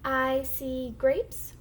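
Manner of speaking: slow, stretched model sentence for beginners; slow model reading. Shown as a demonstration. Teacher models a sentence for the class to echo, slow model reading. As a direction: read slowly word by word, rising at the end. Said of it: "I'm gonna read the sentence first".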